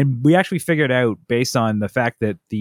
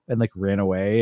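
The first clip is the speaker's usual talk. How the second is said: ordinary delivery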